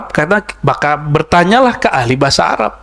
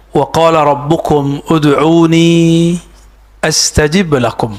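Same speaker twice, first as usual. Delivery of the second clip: recitation; slower than usual. Acted. Recites Quran verse.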